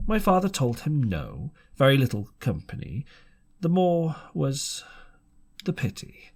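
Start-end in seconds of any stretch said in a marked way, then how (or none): none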